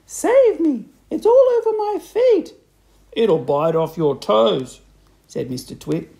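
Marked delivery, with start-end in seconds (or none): 0.0-2.5 very high, loud shriek; screamed panic. A narrator's reading. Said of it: "screamed Missus Twit". 3.1-4.8 loud, slow, drawn-out warning; menacing taunt. A narrator's reading. Husband teasing his terrified wife.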